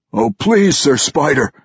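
terrified pleading gasp; gasping plea. A narrator's reading. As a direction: beg for mercy with the blade at his ear. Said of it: "Kermelon gasped".

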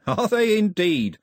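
high, loud, slow mock interest; cheerful villain. A narrator's reading. Cox answers Daphne's warning, cheerfully mocking. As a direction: draw it out with jovial menace. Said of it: "said Cox cheerfully".

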